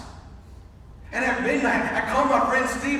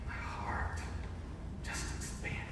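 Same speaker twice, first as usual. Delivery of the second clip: whispered, much softer; hushed; 14 dB quieter than usual. Real, not acted. Father moved by his angry son, whispering. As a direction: breathe the words out, moved.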